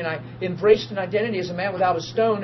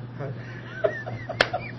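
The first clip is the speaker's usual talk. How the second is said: high laugh; 7 dB quieter than usual. Real, not acted. Laughing at own joke.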